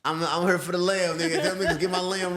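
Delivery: ordinary talk, nothing marked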